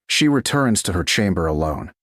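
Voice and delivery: usual speaking voice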